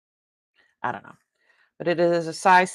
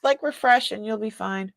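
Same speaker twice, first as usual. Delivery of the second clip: ordinary talk, nothing marked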